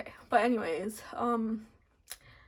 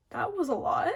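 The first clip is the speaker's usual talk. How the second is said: relieved laugh; 7 semitones higher, 3 dB louder than usual. Real, not acted. Relief after crying during reading.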